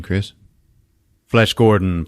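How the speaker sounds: usual speaking voice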